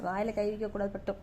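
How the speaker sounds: quick, hushed, low-pitched correction; low gentle admonition. Real, not acted. Correcting baby's hand in mouth. (தாழ்ந்த குரலில் சட்டென்று கண்டித்தல்)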